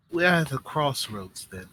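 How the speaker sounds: lightly voiced deliberate schemer, measured pauses; a hint of an in-character strategist weighing options; composed woman plotting. Woman asks Tanari whether to defy or play along, lightly voiced deliberation. Game master plays the woman.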